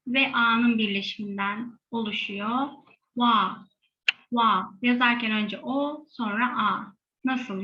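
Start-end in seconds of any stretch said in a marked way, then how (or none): 3.1-4.7 wa said louder and clear; wa as o and a said fast together; syllable demo. Shown as a demonstration. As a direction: model wa twice. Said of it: "wa diye okuyacağız".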